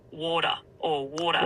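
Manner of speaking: Australian "water" with the final r dropped; "water" in an Australian accent. Shown as a demonstration. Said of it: "So in an Australian accent".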